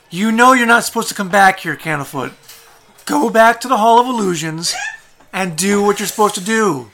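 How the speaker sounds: high, loud nagging with a laugh; scolding clown. Acted. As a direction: order the stray back to post.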